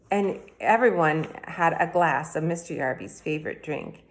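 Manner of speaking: ordinary delivery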